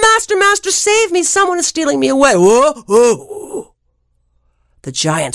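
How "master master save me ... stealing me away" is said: much higher, fast shrill cry for help; harp crying out. Acted. Stolen harp calls to the giant, much higher and fast. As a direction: panic for your master. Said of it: "The harp was crying".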